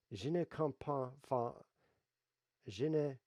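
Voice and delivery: foreign accent in French. Shown as a demonstration. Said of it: "how do you say, "I don't understand"?".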